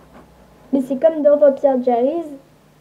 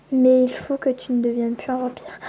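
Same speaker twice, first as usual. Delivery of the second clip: conseil chuchoté. Acted.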